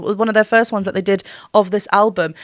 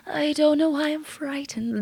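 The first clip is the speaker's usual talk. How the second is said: light, breathy sung imitation, higher and softer; 7 semitones higher, 6 dB quieter than usual. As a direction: let air leak through the words, hesitant. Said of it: "Notice how much breath is coming through".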